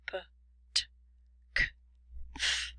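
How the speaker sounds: "p, t, k, f" as bare puffs of air, no throat buzz; voiceless consonants. Shown as a demonstration. Teacher lists voiceless sounds for learners, airy "p, t, k, f". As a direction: isolate "p, t, k, f" without voicing. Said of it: "And the voiceless sounds are".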